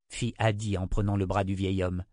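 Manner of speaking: ordinary delivery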